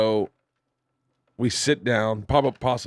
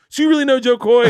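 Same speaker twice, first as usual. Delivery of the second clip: excited nurses; 12 semitones higher, 8 dB louder, faster than usual. Retold or imitated. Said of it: "They're like".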